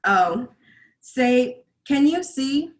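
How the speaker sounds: spoken, high and loud, rising question; pointed challenge. Acted. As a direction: speak the anthem words as a challenge, word by word. Anthem words turned into spoken challenge.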